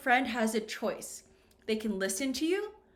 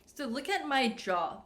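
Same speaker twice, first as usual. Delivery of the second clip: usual speaking voice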